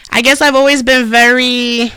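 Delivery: ordinary talk, nothing marked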